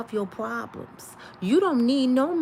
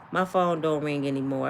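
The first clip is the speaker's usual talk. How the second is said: ordinary talk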